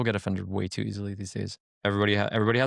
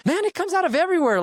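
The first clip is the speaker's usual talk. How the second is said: much higher and louder, a fed-up cry; exasperated outburst; 5 dB louder than usual. Retold or imitated. Kid fed up with teasing relatives, exasperated high cry. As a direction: throw it up high in disbelief. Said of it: "I'm just sitting here like".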